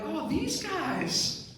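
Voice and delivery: high, drawn-out fond surprise; acted delighted recognition. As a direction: coo it high and slow, like greeting old favorites. Acting delighted discovery of wedding shoes.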